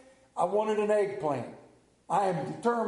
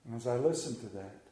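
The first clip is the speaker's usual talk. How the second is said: solemn preaching; 8 semitones lower than usual. Acted. Slow solemn preaching turn.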